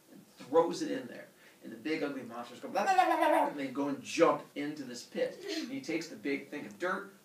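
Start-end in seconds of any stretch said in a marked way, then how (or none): 2.7-3.5 high, loud happy gabbling; monster babble. Retold or imitated. Ugly giant kids babbling as they jump into the pit. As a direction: babble gleefully like giant toddlers.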